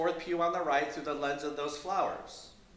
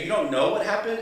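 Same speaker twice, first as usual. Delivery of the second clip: "You don't KNOW what happened?" pitched up and louder, rising; incredulous repeat of "You don't know what happened?", higher and louder; shifted emphasis; 6 semitones higher, 5 dB louder, faster than usual. Retold or imitated. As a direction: imitate her pressing the question with new emphasis on "don't know". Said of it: "As if changing the emphasis on a different syllable".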